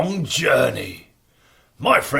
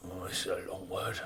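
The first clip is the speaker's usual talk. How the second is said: quiet aside; 9 semitones lower, 17 dB quieter, much faster than usual. Acted. Giant aside, struggling to read.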